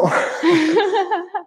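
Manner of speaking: high bright laugh; a real laugh at the joke; burst of laughter. Real, not acted.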